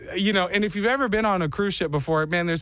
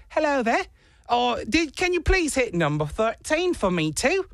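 higher, hammed-up British accent; thick British accent; 7 semitones higher than usual. Polite elevator stranger asks for floor, mock British accent. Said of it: "very thick British accent".

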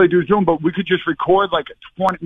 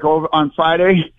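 ordinary delivery

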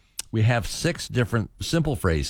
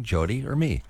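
ordinary delivery

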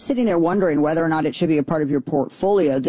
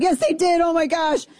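thrilled; 11 semitones higher than usual. Real, not acted. Thrilled by the website.

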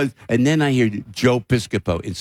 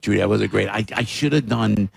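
ordinary talk

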